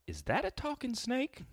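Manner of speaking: high, slow, dopey question; dumb voice. Retold or imitated. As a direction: ask it like a clueless guy. Comic's punchline character voice.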